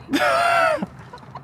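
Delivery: real high, squealing laugh; high peal of laughter; burst of laughter. Real, not acted. Amused by off-camera coffee prompt.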